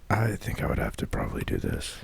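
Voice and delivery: breathy whispered Batman; hushed gravel whisper. Acted. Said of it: "my Batman would be".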